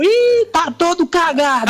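brother teasing. Retold or imitated. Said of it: "colocou a cara pra fora e falou".

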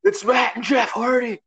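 high, slow shout of amazement; amazed fans. Retold or imitated. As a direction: fans losing it in disbelief. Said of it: "And they're like".

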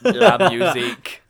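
slow, loud, mechanical robot voice. Pretend. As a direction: speak like a robot, word by word. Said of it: "Just do the robot voice all the way through".

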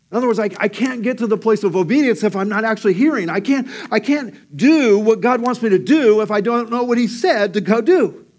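loud, fast, high preaching; preaching at full force. Acted. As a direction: drive the point home, preaching hard.

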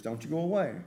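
ordinary talk, nothing marked

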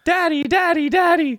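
put-on high, squeaky little girl calling. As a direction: call out like a small child. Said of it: "running around in circles going".

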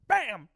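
loud punchy impact noise; bam sound effect. Retold or imitated. Storyteller voicing a chop landing, a loud bam. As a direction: smack the word out like a hit.

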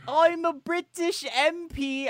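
high, slow, pompous British MP; mock British accent. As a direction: announce it in cartoon British. Claims to be a British MP.

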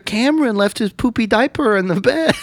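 high, loud passive aggressive mom; high, loud, sugary passive aggression. Retold or imitated. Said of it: "Oh, like, passive aggressive".

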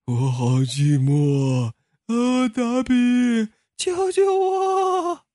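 very slow, rising to a high pleading wail; a trapped soul begging for rescue; creepy doll voice. Acted. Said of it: "所有的娃娃都在说话".

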